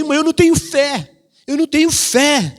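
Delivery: lightly voiced lament; mock complaint. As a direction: imitate a discouraged churchgoer. People complaining about faith.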